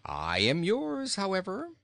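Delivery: high, cheery reply; jolly king, lilting upward. A narrator's reading. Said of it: "was the king's cheery reply".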